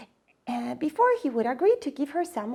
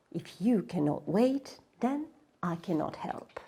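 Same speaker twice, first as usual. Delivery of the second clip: low and much softer; calm refusal; 4 semitones lower, 7 dB quieter than usual. Acted. The waterfall refusing Miyuki, low and soft. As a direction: answer gently but firmly.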